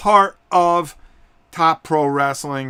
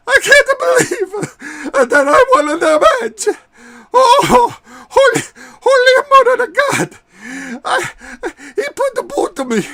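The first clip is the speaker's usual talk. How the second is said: very high, loud, tearful wrestler voice; weeping winner interview; 8 dB louder than usual. Acted. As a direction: sob through the win as an overwhelmed Italian wrestler. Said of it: "Let's hear from the victor".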